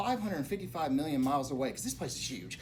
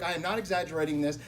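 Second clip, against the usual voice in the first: usual speaking voice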